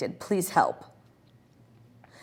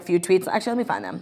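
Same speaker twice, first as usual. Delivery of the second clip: ordinary delivery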